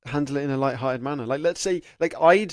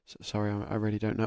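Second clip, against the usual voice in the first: much softer, lower, timid mumble; nervous apology; 6 semitones lower, 10 dB quieter than usual. Shown as a demonstration. Interview candidate apologising in a soft, timid voice. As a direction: shrink away like a flustered candidate. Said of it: "The whole point is the attitude".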